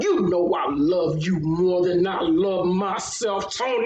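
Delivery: slow, slurry imitation of the drunk man; mock drunk husband. Imitating the drunk husband to his wife, slow mock slur. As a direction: lightly ham up a drunk declaration. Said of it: "He was like".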